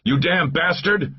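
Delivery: high, drawn-out furious accusation; angry yell. A narrator's reading. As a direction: yell it in his face. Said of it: "yelled in his face".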